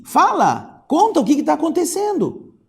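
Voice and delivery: high, urgent loud command; imperative tone. A narrator's reading. Alarmed guide ordering Luísa to explain, imperative. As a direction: demand an answer in alarm. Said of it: "em tom imperativo".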